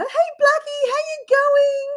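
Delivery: high-pitched cooing to a pet; baby talk. Retold or imitated. Greeting her blind chicken in baby talk. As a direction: coo in a drawn-out, high baby voice. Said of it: "make those baby noises that you do".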